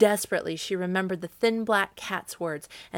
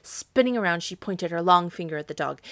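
ordinary delivery, nothing marked

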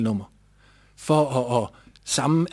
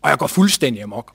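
ordinary delivery

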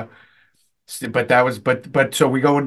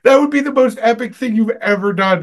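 gleeful awe; 8 semitones higher than usual. Retold or imitated. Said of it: "I was like".

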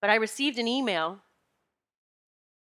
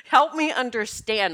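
retold plea, raised in pitch and volume; yelling out to God; 5 semitones higher, 6 dB louder than usual. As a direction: re-enact yelling to God for help. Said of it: "I was really yelling out to God".

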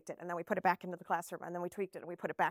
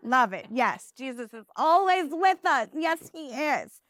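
teacher praising; 5 dB louder than usual. Shown as a demonstration. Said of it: "teacher praising child's answer".

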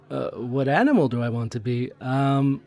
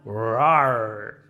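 drawn-out mock roar; playful lion roar; 3 semitones lower than usual. Conductor roaring at her reflection in the glass, playful. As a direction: growl like a toy lion. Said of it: "saw my reflection".

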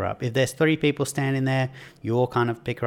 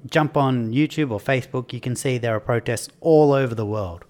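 normal talking voice

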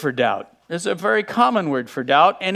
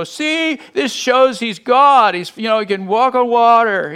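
raised, louder voice of commentators proving a point; mock triumphant insistence; 4 semitones higher, 4 dB louder than usual. Mimicking commentators making their point.

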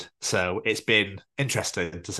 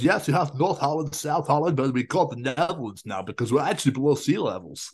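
slightly raised, sing-song impersonation; imitating Dutch colleague; 5 semitones higher than usual. Retelling a Dutch guy explaining the Netherlands, lightly put-on voice. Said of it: "Is that what he sounded like?".

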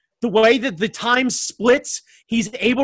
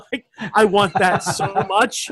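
usual speaking voice

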